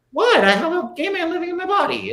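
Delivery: very high, loud mock outrage; hammed-up high-pitched indignant impression; Sonya Morgan. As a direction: imitate her squealing in disbelief. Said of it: "she's one of those people that would be like".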